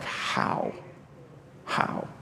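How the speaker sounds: acted breathless disbelief; stunned whisper. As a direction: whisper it as a stunned debtor would. Debtor stunned at debt cancelled.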